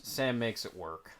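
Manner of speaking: soft, slow tagline; mock slogan. Cohost turning the line into a slow, soft mock tagline. Announcer-style tagline for the audience.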